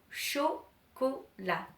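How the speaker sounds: "Chocolat" slowly broken into three separate syllables. Shown as a demonstration. Teacher offers children another word, syllable by syllable. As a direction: say "Chocolat" with a pause after each syllable. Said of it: "on va prendre un autre mot".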